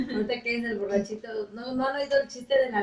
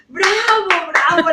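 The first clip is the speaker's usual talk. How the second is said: loud mock cheer; hammed-up bravo; 5 semitones higher, 8 dB louder, slower than usual. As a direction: cheer like a drunk crowd. Drunks cheering again.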